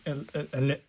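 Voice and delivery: stammers on il-, then bursts out loud and high on illuminated; stumbling then blurting. Real, not acted. Practising handwriting and tripping over the word, stammering then blurting. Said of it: "can't even say that".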